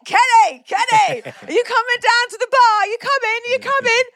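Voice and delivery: high, loud hollering to Kenny; mock yelling. Calling Kenny down to the bar, mock yelling. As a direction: holler it high as if through a door. Retells calling through hotel door.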